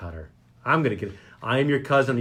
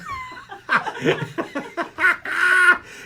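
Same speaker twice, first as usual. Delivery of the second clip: long, much higher cackling laugh; high cackle. Real, not acted. Host laughing after joking he's bored, high cackle. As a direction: let the laugh break out high.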